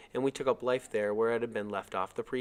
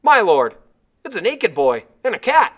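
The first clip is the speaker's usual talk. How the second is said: very loud, higher astonished shout; yelled; 10 semitones higher, 15 dB louder than usual. Acted. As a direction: shout the news back to the boat. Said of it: "he yelled to someone on the boat".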